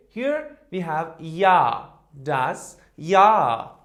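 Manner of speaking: Jahr said yah, slow and loud; model with intonation. Shown as a demonstration. Teacher repeating das Jahr for the class, emphatic. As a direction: stress Jahr, slow and firm. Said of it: "increasing intonation".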